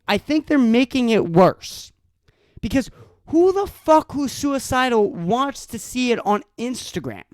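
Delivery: usual speaking voice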